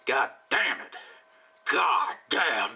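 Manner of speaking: lightly voiced walrus grumble; a hint of a gruff walrus cursing; mild put-on walrus mutter. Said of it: "That's how the walrus speaks".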